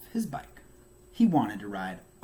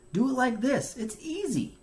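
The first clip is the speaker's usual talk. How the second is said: high bragging voice, as if it is nothing; showing off Bear; 11 semitones higher than usual. A narrator's reading. Bear to Beaver at the monkey bars, high bragging voice. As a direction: show off to a struggling friend. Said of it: "said Bear".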